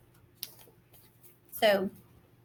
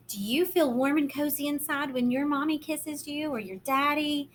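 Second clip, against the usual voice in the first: plain reading voice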